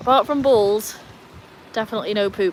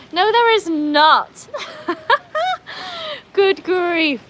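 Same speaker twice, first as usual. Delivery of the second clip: loud, high, slow denial breaking into laughter; real laughing indignation, pitched high; amused protest; 10 semitones higher, 5 dB louder, much slower than usual. Real, not acted. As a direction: protest in mock outrage, then laugh. Laughing protest at viewer's comment.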